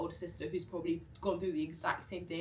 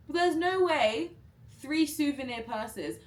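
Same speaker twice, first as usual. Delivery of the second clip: high, loud and slow in disbelief; louder, higher incredulous protest; outraged disbelief; 8 semitones higher, 6 dB louder, slower than usual. Real, not acted. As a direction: stretch it out, can't believe it. Realizing she's overcharged.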